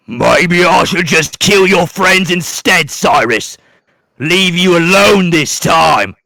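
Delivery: villain turning on the hero's friends; menacing villain. Acted. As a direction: let the threat hang, toying with him. Villain Roland threatens friends.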